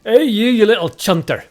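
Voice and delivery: loud, high Glaswegian call; put-on Glaswegian shout; Glaswegian street heckler. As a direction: accost someone like a rowdy Glaswegian. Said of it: "imagine a Glaswegian strolling up to somebody".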